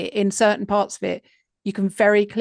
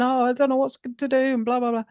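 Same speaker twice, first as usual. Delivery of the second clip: higher, whiny imitation of tearful panic; mock crying; 3 semitones higher, much faster than usual. Podcaster retelling a caller's renewed distress, mock crying. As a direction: imitate a caller going back into tears. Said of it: "and he'll be, uh, crying".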